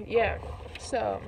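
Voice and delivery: normal talking voice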